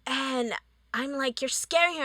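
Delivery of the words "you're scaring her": screaming. Retold or imitated. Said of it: "I start screaming at her".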